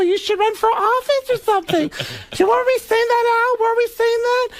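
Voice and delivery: Beverly D'Angelo impression. Comedian retelling the elevator meeting, high gushing woman. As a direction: squeal it like an oblivious starstruck actress. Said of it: "gets off the elevator and goes".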